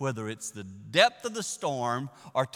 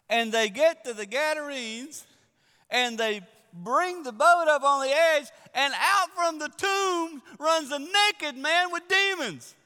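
high, loud, slow sermon build; full-force preaching; 9 dB louder than usual. Acted. Preacher building the story to the church, loud. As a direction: drive the story home to the congregation.

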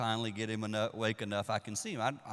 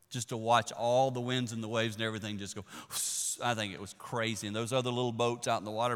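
ordinary talk, nothing marked

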